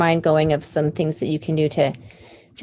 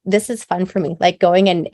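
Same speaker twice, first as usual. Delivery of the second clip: ordinary talk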